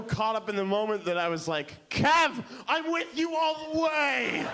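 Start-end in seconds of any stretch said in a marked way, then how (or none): 1.9-4.5 high, loud call, stretched out; carried-away shout. Retold or imitated. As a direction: shout your support, drawing it out. Caught up, calls to Kev.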